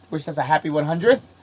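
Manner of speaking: usual speaking voice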